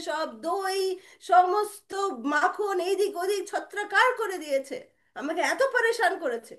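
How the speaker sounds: exasperated mother. Acted. Mother telling of Krishna's mischief, high exasperated scolding. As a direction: throw the denial back and list the damage.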